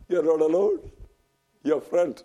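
ordinary talk

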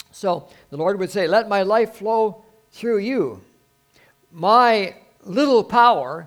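loud, high, drawn-out proclamation; full-force preaching. Acted. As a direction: preach it out big, stretching the key words.

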